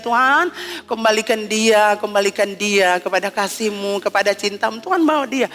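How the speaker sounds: prayer. Retold or imitated. Quoted prayer. (doa yang lirih dan pelan)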